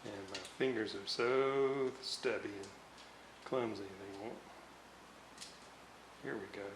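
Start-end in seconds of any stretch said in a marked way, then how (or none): none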